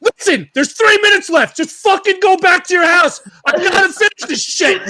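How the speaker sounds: high, loud, hammed-up irritated rant at the customer; mock angry rant. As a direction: play the fed-up listener, half yelling and half laughing. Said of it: "Why were you so angry?".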